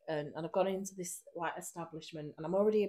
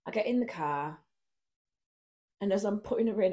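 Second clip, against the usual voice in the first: ordinary delivery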